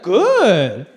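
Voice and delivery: dad impression. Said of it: "my dad was like".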